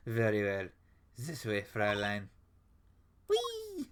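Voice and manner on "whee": high delighted whee; gleeful squeal. Acted. Girl dashing in with a high gleeful squeal. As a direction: squeal with joy, much higher.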